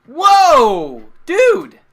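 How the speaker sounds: loud, high excited yell; excited shout. Real, not acted. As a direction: shout with delight. Big donation just came in.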